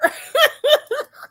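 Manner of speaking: a high, real giggling laugh; high burst of laughter. Real, not acted. Giving dating advice to viewers, high giggle.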